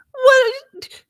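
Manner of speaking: high, loud retold yelp; hammed-up incredulous cry; outraged disbelief. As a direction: squawk it in shocked indignation. Her outraged reply to husband.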